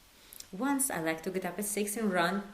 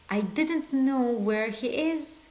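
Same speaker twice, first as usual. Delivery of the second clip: very slow, stressing didnt; slow prompt; 3 semitones higher, much slower than usual. Shown as a demonstration. Teacher giving learners a sentence to translate. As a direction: spell out I didn't know where he is slowly. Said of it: "How do we say that?".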